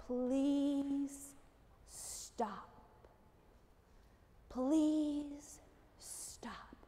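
soft, low whisper with long pauses; whispered plea from a tiny voice; gentle butterfly voice. Acted. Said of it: "in a gentle butterfly squeak, but-, butterfly sneeze voice".

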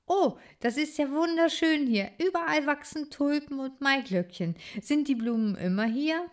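put-on a curious young critter speaking up; lively little hero. Bodo voiced by reader.

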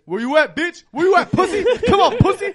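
loud, high mocking taunt; taunting yell. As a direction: yell to bait him out. Retold enemy taunting on proxy chat.

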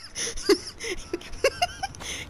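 helpless high-pitched laughter; high cackling laugh. Real, not acted. Player amused by the game's bridge kills, high cackle. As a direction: burst into high, breaking laughter.

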